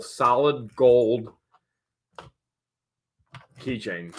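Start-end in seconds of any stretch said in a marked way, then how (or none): none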